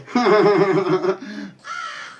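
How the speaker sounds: burst of laughter. Real, not acted. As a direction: laugh out at the insult. Laughs at the monkey insult.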